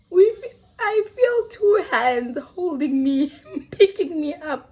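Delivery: giggling as the memory hits; laughing. Real, not acted. Laughing while recalling being lifted by the bouncers.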